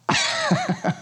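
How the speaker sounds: a quick high chuckle at the absurdity; short high laugh. Real, not acted. Preacher laughing at church members' odd claims, short high chuckle. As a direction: laugh briefly, amused.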